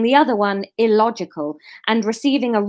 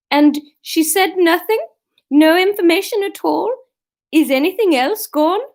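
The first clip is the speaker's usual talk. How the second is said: put-on Swedish accent; 8 semitones higher, 6 dB louder than usual.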